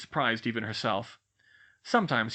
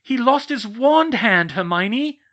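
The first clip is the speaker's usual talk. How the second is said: slow, loud, high yell; yelling back at Hermione; 11 semitones higher, 10 dB louder, slower than usual. Acted. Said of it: "Cho yelled back".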